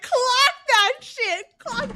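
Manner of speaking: high, slow squealing cheer; cheering on the heroine with a high excited squeal. Retold or imitated. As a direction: squeal it out, egging her on.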